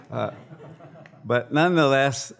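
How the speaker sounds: ordinary delivery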